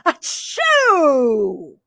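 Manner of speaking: big drawn-out achoo; pretend sneeze. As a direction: sneeze theatrically, stretching the end. Acting out a sneeze.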